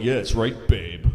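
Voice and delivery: low, self-satisfied mock reply; smug husband. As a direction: drop low on babe, pleased with yourself. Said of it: "he had this smug look like".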